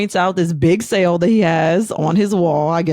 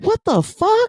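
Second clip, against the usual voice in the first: mock baffled husband; slower than usual.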